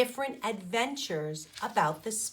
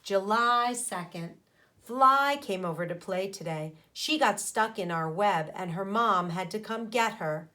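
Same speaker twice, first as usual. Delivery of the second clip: usual speaking voice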